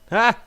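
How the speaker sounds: short sharp scream of fright; sudden frightened yelp; startled scream. Acted. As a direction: jump and scream at a tap on the shoulder. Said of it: "I screamed".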